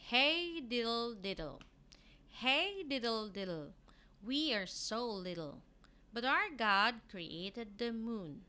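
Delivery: teacher reading the nursery rhyme aloud to pupils. Acted. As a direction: read it out bright for young pupils. Rhyme read again.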